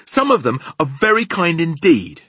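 high, loud indignant retort; angry cry. Acted. Sophie defending humans to the giant, angry. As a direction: argue back loudly and high. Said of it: "Sophie cried angrily".

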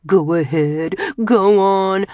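loud, daring jeer; goading. Acted. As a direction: dare him to cry. Jamil goads Boutros.

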